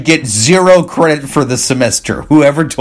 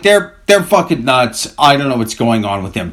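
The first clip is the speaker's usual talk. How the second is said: normal talking voice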